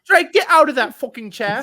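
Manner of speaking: hammed-up furious shout at Drake; mock angry yell. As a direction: scold him like an outraged classmate. Yelling at the character Drake.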